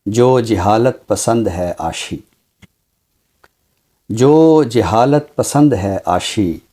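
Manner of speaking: measured, unhurried reciting of a couplet; ghazal recitation. Acted. Poet recites his ghazal for the host, lingering and measured. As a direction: lift the opening line like a poet at a mushaira. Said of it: "गज़ल आज आपने अता की".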